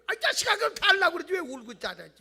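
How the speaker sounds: high, loud fatherly scolding; a father telling off his crying son; exasperated father. Retold or imitated. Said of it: "그러면". (우는 아들을 나무라는 아버지)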